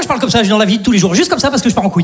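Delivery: ordinary delivery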